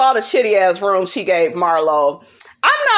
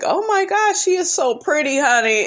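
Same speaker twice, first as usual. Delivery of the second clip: gushing excited quote; 7 semitones higher than usual. Retold or imitated. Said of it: "I was like".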